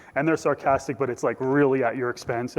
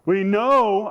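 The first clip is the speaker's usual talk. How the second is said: high, loud pronouncement; proclamation; 6 semitones higher, 5 dB louder than usual. Acted. Pharisees proclaiming what they know, lofty and loud. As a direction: declare it loudly as settled fact. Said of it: "one of these proclamations".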